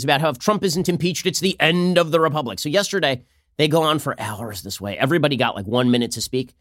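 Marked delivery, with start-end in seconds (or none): none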